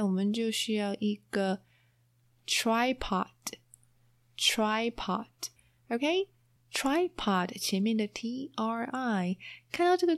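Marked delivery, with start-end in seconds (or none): 2.5-7.6 very slow, clear tripod; slow model pronunciation. Shown as a demonstration. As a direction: draw out tripod slowly. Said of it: "slow model pronunciation".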